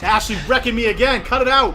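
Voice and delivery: loud frustrated yell; yelling across the room. Real, not acted. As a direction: shout it at her. Yelling at opponent Ashley.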